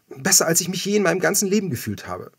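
elated. Acted. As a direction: beam with happiness.

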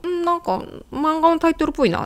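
musing. Acted. Learner testing a phrase in Japanese, lightly acted musing. Acted pondering over an example.

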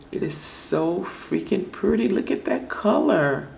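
high, swooping excitement; gushing delight. Real, not acted. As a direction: gush with the pitch jumping up. Gushing over the polish color.

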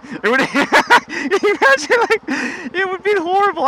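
high, slowed words broken by laughter; laughing through words. Real, not acted. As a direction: laugh while picturing it. Laughing at the idea.

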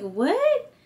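high, incredulous retold what; a disbelieving squeak of a what; mock outrage. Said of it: "I'm like".

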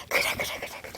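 breathy whispered yes yes; hushed eager agreement. Acted. 속삭이듯 작게 대답하는 소리.

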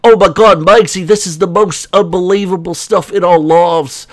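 hammed-up woe in a put-on voice; mock distraught husband. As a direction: whine to your wife like a put-upon husband, mockingly. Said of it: "He's so".

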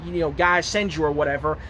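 ordinary delivery

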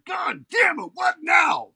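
furious shout. Retold or imitated. Dog owner cursing at midnight mess, shouting loud and high. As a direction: bellow it across the dark bedroom. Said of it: "my wife and daughter hear".

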